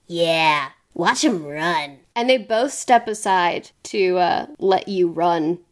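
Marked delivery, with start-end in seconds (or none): 0.1-2.0 low, slow character voice; Jerry. Acted. Game master voicing Jerry, low slow drawl. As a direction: draw the words out low and unhurried. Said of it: "And Jerry says".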